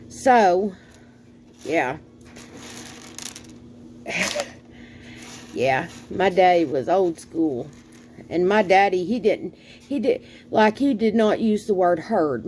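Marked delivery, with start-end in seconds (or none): none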